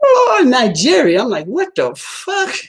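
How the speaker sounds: high, drawn-out mock amazement; acted astonishment. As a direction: stretch it out high in exaggerated disbelief. Acts out reaction to the result.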